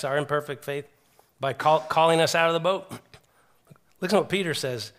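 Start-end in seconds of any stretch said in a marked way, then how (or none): none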